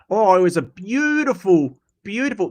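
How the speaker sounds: lightly put-on broad Queenslander accent; Steve Irwin. Streamer showing chat how Steve Irwin spoke, light Queenslander impression. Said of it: "Steve Irwin was actually a Queenslander accent".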